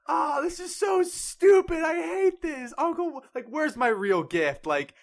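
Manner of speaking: put-on bratty kid tantrum. Birthday boy whining at his uncle about the CD. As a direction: shriek it like a bratty kid trashing a gift. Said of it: "And he goes like".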